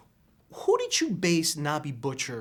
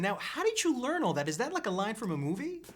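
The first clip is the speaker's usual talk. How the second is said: ordinary talk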